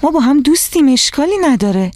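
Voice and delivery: put-on indignant child's defence; offended. A narrator's reading. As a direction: protest that it wasn't on purpose. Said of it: "روزا دلخور گفت".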